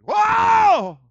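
loud, high-pitched wail; wailing scream. Retold or imitated. Woman at the crystal ball confronted by a preacher, wailing scream. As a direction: scream it out as if possessed. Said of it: "She started screaming".

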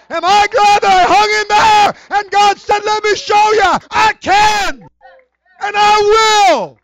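shouted, high, slow preacher's climax; preaching at full force. Acted. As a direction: bring the church to its feet.

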